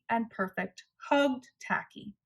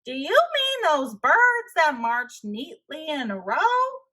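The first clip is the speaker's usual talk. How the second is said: higher, louder goofy question; Tacky's voice; 6 semitones higher, 4 dB louder than usual. Acted. Tacky teases the puzzled hunters, goofy and bright. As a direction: ask it like a cheerful clown.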